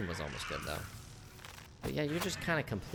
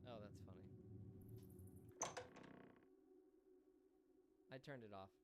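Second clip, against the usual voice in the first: far off and much softer, away from the mic; off-mic aside; 4 semitones higher, 21 dB quieter, faster than usual. Real, not acted. Streamer muted, talking to someone in the room, far off.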